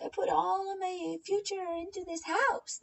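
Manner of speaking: high, whiny mock voice of the girl; mocking a girl's whiny voice; mocking quote. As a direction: parody her claim in a whiny voice.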